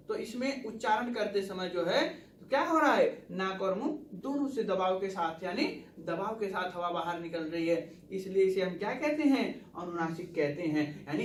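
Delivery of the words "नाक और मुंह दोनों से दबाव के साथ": ordinary delivery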